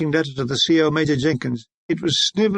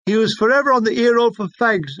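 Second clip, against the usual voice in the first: ordinary delivery, nothing marked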